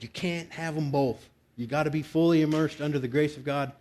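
normal talking voice

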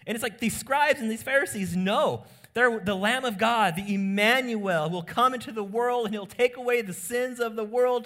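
preacher at full force condemning self-righteousness; preaching at full force. Acted. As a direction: drive it home to the congregation.

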